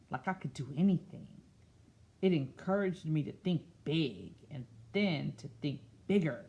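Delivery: slow storybook read-aloud; picture book voice; reading to children. Acted. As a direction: read it gently to a class.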